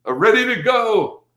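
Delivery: very high, squeaky mock eagerness; squeaky eager. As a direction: squeal it like a kid who can't wait. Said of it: "You know what I mean?".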